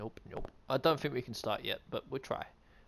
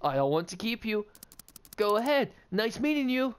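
higher voice; 7 semitones higher than usual. Pretend. Voicing player character.

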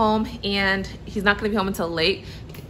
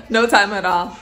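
laughing it out; 5 dB louder than usual. Real, not acted. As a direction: say it through a laugh. Laughs at baby whining.

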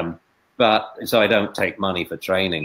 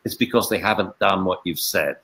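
usual speaking voice

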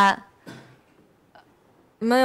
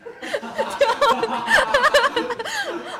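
long, high-pitched giggle; high embarrassed giggle. Real, not acted. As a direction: break into a high giggling fit. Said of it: "有点尴尬".

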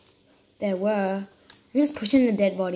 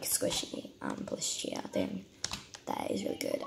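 soft whisper to herself; 11 dB quieter than usual. Real, not acted. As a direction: breathe it out, no voice. Hugging plushie while playing.

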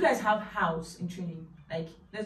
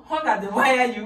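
laughing; 8 dB louder than usual. Shown as a demonstration. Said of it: "We say".

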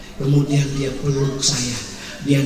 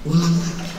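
ordinary talk

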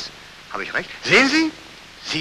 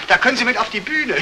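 laughing; 9 semitones higher, 4 dB louder than usual. Acted. Colonel mocks, laughing.

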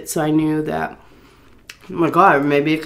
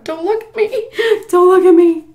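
high, squeaky tearful plea; hammed-up sobbing wail, half laughing; mock crying; 14 semitones higher, 6 dB louder than usual. Embarrassed woman to staring drivers, mock crying. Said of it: "I was crying".

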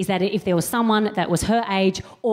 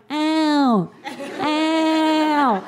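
mock pain; 6 semitones higher than usual. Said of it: "lying there going".

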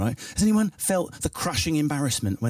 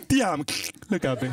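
exaggerated jaw-stretching Italian; impassioned Italian. Italian man declares love, exaggerated Italian accent. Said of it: "dislocates his jaw".

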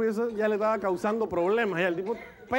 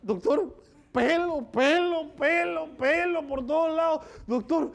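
higher, slow, climbing on each pelo; pleading patient; 7 semitones higher, much slower than usual. Acted. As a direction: beg the doctor, stretching every pelo. Patient shows hairy legs, pleading. (paciente suplicando al doctor, agudo y lento)

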